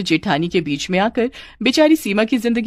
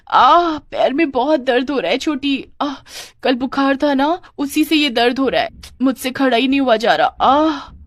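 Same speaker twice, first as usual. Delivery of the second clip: high, moaning show of pain; feigned pain; 6 semitones higher than usual. Pretend. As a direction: groan and whine as if your leg hurts. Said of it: "दर्द का नाटक शुरू कर". (दर्द का नाटक, कराहती ऊँची आवाज़)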